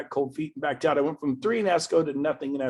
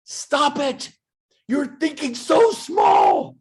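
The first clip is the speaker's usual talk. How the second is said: loud, slow, high scolding shout; shouting at the audience to dream bigger; 10 semitones higher, 7 dB louder, much slower than usual. Real, not acted. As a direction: yell it at them to wake them up.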